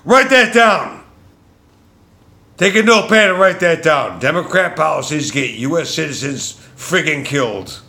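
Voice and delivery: high, loud enraged yell peaking on killed; real anger shouted out; furious shouting. Real, not acted. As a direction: scream the outrage at the camera. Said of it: "Yes, I'm very angry".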